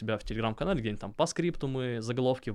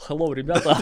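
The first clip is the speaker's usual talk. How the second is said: put-on Russian with a heavy American accent; loud, hammed-up American accent greeting; 5 semitones higher, 9 dB louder than usual. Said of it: "на русском с американским акцентом говорил".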